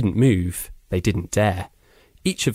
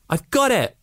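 high, thrilled cry of triumph; a boy shouting that he's got the ball; excited cry; 14 semitones higher than usual. A narrator's reading. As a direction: call out the find, delighted. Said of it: "Rise cried".

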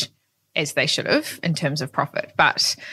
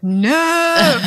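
higher, louder cry of dismay; a loud, despairing no at the lost bag; 10 semitones higher, 6 dB louder than usual. Retold or imitated. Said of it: "I was like".

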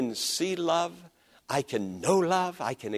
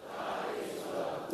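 slow, soft murmured response; slow quiet congregational reading; congregation reading softly; 13 dB quieter than usual. Real, not acted. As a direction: voices read the verse back together. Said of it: "Read that with me".

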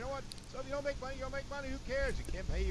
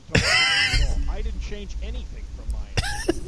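furious shout, much louder; real angry yell; 9 semitones higher, 12 dB louder than usual. Real, not acted. Host yelling at the producer over a broken radio line, furious shout. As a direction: yell in real frustration at the tech. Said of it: "I just yelled at Theodore".

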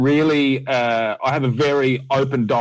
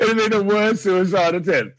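higher, laughing self-mockery; 6 semitones higher than usual. Real, not acted. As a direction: laugh at yourself. Laughing at himself.

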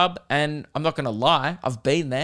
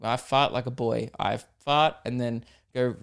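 usual speaking voice

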